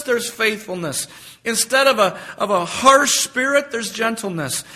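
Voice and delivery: high, loud, emphatic "instead of" build-up; fervent preaching. Acted. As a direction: preach each contrast with rising fire. Preaching contrasts, rising fervor.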